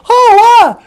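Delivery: high, loud startled yelp; startled cry. Acted. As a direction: yelp as the tree comes down. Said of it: "he was like".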